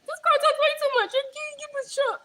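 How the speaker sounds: high squeaky mocking voice; mock complaining viewers. Streamer mimics viewers fed up with her chatter, high mocking voice. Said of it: "y'all will be like".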